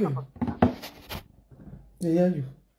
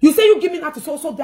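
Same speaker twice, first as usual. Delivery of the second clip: loud, higher accusing line; angry rebuttal, louder; heated retort; 6 semitones higher, 5 dB louder, faster than usual. Real, not acted. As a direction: hit back at her hard. Quarrel, throwing words back.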